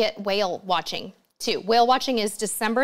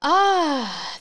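loud breathy "ah" after a deep breath; 4 semitones higher, 3 dB louder than usual. Real, not acted. Livestream host pausing from nonstop talking, deep breath. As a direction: let out one long sighed "ah". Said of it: "Just taking a deep breath".

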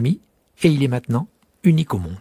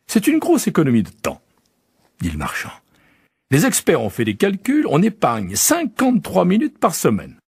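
higher, brisk salesman voice; the merchant boasting to the prince about his pills; 5 semitones higher than usual. Acted. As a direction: sell it like a pushy pitchman. Merchant character.

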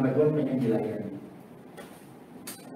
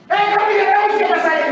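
high, loud indignant challenge; angry retort; 10 dB louder, faster than usual. Retold or imitated. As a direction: demand it loudly, offended. Angry man in example. (bentakan tersinggung yang keras)

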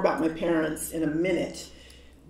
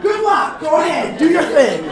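much higher, louder laughing cheer; cheering shout; 11 semitones higher, 15 dB louder than usual. Retold or imitated. Clerk cheering the speaker on, loud and laughing. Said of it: "he was like".

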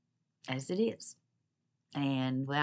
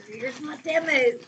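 loud, high, drawn-out cheer; excited announcement; 8 semitones higher, 6 dB louder than usual. Real, not acted. Vlogger presenting her Temu haul, loud and excited. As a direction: show it off at a raised pitch, slowly.

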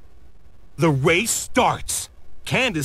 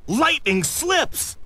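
higher and slower, drawn out with alarm; dramatic gasp; 8 semitones higher than usual. A narrator's reading. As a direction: let the slip land like a sudden shock. Exciting moment in race story.

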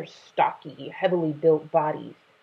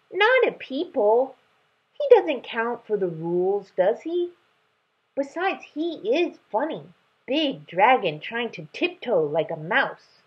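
put-on childish cub; 7 semitones higher than usual. Cub protests to mother.

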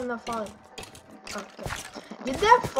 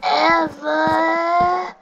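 slow, drawn-out loud hype; exaggerated fanboy bellow; mock gushing fan; 3 semitones higher, 6 dB louder, much slower than usual. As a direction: stretch each word into a mock cheer. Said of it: "it's not like".

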